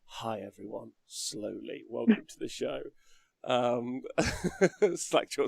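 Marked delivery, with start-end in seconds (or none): 0.0-2.8 deliberately slowed down. Retold or imitated. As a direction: rein yourself in, easing into the welcome. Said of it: "sit down and be like".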